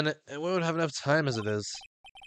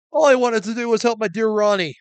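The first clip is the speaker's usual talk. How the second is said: higher, louder doting wife voice; devoted wife voice; 8 semitones higher, 7 dB louder than usual. Acted. Devoted wife telling the detective she only wanted to help, higher, louder. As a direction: pour your heart into it for your man.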